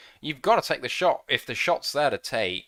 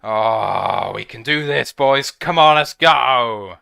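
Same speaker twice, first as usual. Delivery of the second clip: loud rallying call, slow on let's go; rallying cheer; 9 dB louder than usual. Real, not acted. Fan rallying the Lions players, loud and fired up.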